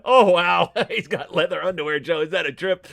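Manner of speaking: normal talking voice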